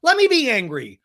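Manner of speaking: high, loud acted defiance; mock angry retort. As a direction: throw it back angrily, hammed up. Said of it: "it just makes you more angry".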